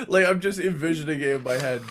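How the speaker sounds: usual speaking voice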